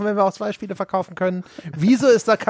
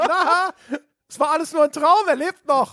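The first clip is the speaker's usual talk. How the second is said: mock dramatic reveal; 8 semitones higher than usual. Acting a cheesy resurrection twist.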